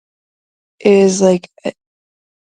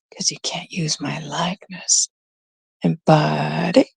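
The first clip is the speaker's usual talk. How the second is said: hushed warning whisper; sly warning whisper; 2 semitones lower, 5 dB quieter than usual. Real, not acted.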